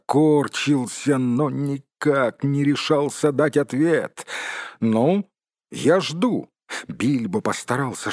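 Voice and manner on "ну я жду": mildly stern, raised voice; stern, confident. A narrator's reading. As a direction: try to sound strict and sure. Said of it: "чтобы его голос прозвучал строго и уверенно". (строго и уверенно)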